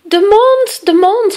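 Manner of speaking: high, loud yell; piercing boy's shriek. A narrator's reading. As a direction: yell it out in high-pitched alarm. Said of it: "gilde Joris".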